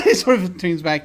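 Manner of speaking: normal talking voice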